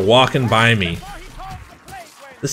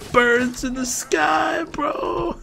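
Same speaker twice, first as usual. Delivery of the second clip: put-on loopy drunk voice; much slower than usual. Drunk high voice.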